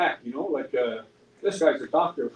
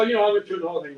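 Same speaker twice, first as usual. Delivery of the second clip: ordinary delivery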